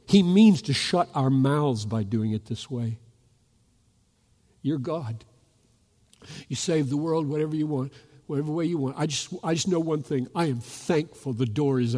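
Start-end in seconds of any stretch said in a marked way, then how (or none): none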